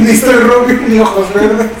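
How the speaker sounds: arranca con una risa, más fuerte; riendo. Real, not acted. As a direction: suelta la broma riendo. Broma sobre parecerse a Link.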